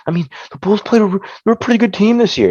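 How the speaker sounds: high mocking imitation of doubters. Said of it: "people like to think".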